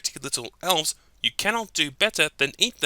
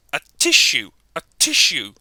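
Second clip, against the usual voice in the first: mock sneeze; 8 dB louder than usual. Sneezing game line.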